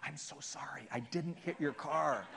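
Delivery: exaggerated stage whisper, drawn out; whispered plea across to another car. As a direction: mouth it slowly in a hushed apology. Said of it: "I'm whispering to them".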